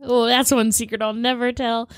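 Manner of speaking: usual speaking voice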